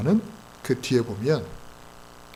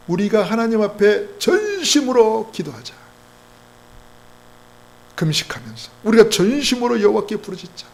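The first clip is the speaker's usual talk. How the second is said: high, stretched preaching cry; rally the people to pray, drawing out 전심으로; raised, urgent exhortation; fervent rallying call; 8 semitones higher, 4 dB louder than usual. Acted.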